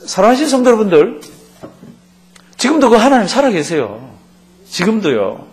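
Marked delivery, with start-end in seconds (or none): none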